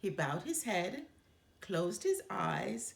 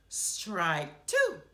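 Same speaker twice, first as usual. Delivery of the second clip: umpire's call; 7 dB louder than usual. Acted. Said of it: "called the ump".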